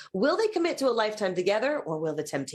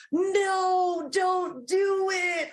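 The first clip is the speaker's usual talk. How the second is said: high, drawn-out plea not to do it; pleading no; 8 semitones higher, much slower than usual. Pretend. Host stops a contestant before a mistake, high and drawn out. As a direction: stretch out a pleading warning.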